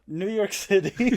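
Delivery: high, loud, stretched-out scene title; insistent dramatic announcement. Acted. Storyteller talks over a confused friend's questions, announcing grandly and slowly. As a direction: draw out the location grandly and insistently.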